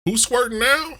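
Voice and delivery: high taunting voice; mock taunting. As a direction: taunt like a sore winner. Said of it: "Michael Strahan gonna come out".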